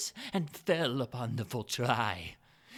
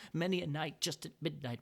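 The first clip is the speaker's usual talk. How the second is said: ordinary delivery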